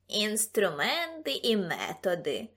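slow, drawn-out mocking echo; exaggerated, dragged-out parroting; mocking mimicry. A narrator's reading. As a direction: mimic the pompous phrase to tease. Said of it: "передражнив його Ксимеріус".

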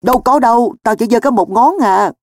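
high-pitched hasty denial; alarmed, defensive exclamation; crying out. Acted. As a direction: protest innocence, voice jumping up. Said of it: "kêu lên".

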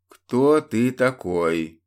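slow, measured demand; cold interrogator. Acted. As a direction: ask it slowly, weighing each word. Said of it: "поинтересовался Северин".

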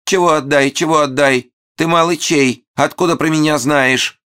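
piercing high falsetto squabble; shrill falsetto. A narrator's reading. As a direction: squawk it in a shrill old woman's falsetto. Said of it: "забазарил пронзительным фальцетом".

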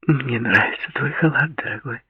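breathy vintage purr. A narrator's reading. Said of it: "промурлыкала она".

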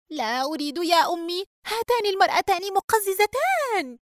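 very high, whiny complaint; fidgety grumbling. A narrator's reading. Daughter refusing to sit with disgusting guests, grumbling. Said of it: "رجاء بخليط من التململ والتذمر".

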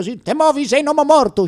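hammed-up robber with a gun; threatening thief. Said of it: "m'ha detto".

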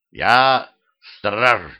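proud, stately announcement. A narrator's reading. Talking head introduces itself to newcomers as the guard, proud. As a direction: declare it with grand self-importance. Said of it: "гордо произнесла голова".

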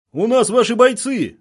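high, loud shout; loud militant retort, pitched high; called-out taunt. A narrator's reading. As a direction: shout the reminder across the lines. Militants remind enemy, calling across.

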